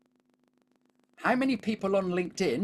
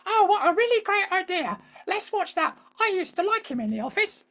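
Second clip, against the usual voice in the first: very high, louder mock-wife voice; wife impression; 3 dB louder than usual. Wife keen on a Netflix show, imitated in a high voice. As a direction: imitate an agreeable wife, pitched way up. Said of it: "it's two different people".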